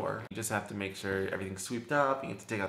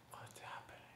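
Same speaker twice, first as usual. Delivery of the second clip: frightened whisper to her friend; breathless hushed question; whispered, almost silent; 21 dB quieter than usual. Retold or imitated.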